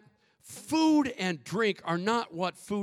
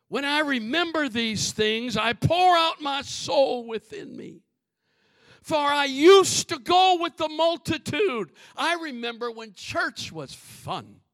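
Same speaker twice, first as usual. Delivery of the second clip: high, ringing scripture declamation; forceful preaching; 8 semitones higher, 6 dB louder than usual. Real, not acted. Preacher reading the psalm of remembered worship, forceful declamation. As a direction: proclaim the verse with raised pitch.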